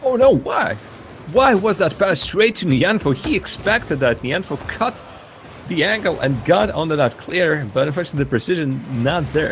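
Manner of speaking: high-pitched, exasperated commentary, easing down gradually; dismayed play-by-play. Real, not acted. Caster lamenting a bad pass, high dismayed call. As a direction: question the play as it unfolds.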